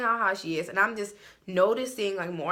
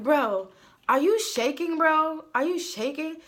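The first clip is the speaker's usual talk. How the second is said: higher and louder, slow rising alarm; retold alarmed question; 7 semitones higher, 4 dB louder, slower than usual. To his friend in bed, a higher, louder alarmed question. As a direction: ask it like you just felt the bed move. Retelling noticing friend shaking.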